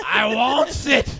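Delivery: very slow loud hungry growl; creepy creature voice. Pretend. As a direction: crave it like a lurking ghoul. Playing the creepy lady.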